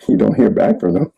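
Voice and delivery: talking through a self-conscious laugh; amused, words breaking into laughter. Real, not acted. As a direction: chuckle helplessly while telling it. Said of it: "I'm laughing because I've done this".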